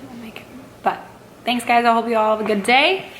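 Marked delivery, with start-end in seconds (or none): 0.1-0.5 much softer, near-whispered repeat; hushed aside. Real, not acted. Vlogger on getting shy husband into videos, hushed aside. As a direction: say it under the breath, almost whispered.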